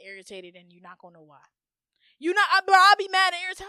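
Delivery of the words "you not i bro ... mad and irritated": loud, high exasperated outburst; worked-up irritation. Acted. Describing her own unexplained anger, loud irritated outburst. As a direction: burst out heated and fed up. Acts out being mad and irritated.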